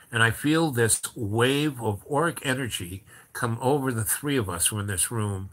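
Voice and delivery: ordinary delivery, nothing marked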